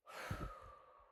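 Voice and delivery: long exhale to steady yourself; settling sigh after tears; composing sigh. Real, not acted. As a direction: blow out a slow breath before starting. Composing self before reading poem.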